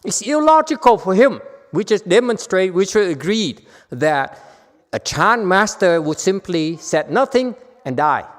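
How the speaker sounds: loud, high, impassioned preaching; fervent preaching. Acted. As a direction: drive the point home at full force. Preaching the master's lesson.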